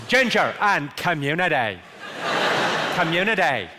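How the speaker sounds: slow, deliberate Belfast; Belfast accent. Shown as a demonstration. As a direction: demonstrate a Northern Irish accent. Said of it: "the phrase I use to get the Belfast accent right".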